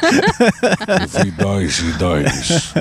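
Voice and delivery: Dolph Lundgren impression. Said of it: "Dolph Lundgren, who's Swedish".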